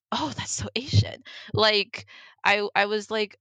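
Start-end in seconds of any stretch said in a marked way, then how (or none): 0.1-1.2 whispered and high, imitating the friend; friend's repeated remark, whispered mockingly; mock whisper. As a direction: breathe it out as a snide aside. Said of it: "And then she's just like".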